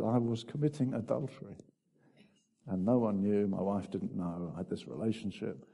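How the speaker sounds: low, subdued retold admission; quiet confession. Preacher retelling an acquaintance's adultery story, quietly confessing. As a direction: confess it softly, pitch dropped.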